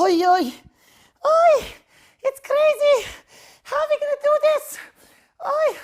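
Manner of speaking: high, loud panicked oi; panicked wailing. Acted. Townspeople panicking at the governor's order, high loud wail. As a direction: cry out in alarm, pitch way up.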